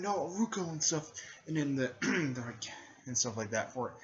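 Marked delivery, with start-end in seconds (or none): 0.0-0.7 high-pitched protest; acted cry of alarm. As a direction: call out to a fallen friend. Friends cry out as she falls.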